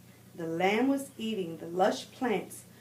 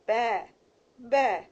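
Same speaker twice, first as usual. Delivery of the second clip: lamb bleat; 3 semitones higher, 4 dB louder than usual. A narrator's reading. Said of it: "Borreguita bleated".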